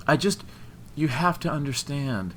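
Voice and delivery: high, halting protest; blurted plea. A narrator's reading. Woman explaining for her best friend, blurted pleading. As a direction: blurt it in broken, urgent phrases. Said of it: "Emily blurted before she was interrupted".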